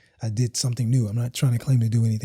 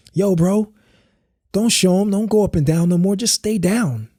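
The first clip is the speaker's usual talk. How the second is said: friend's advice; 7 semitones higher than usual. Retold or imitated. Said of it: "Tone was like".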